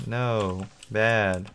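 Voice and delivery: ordinary delivery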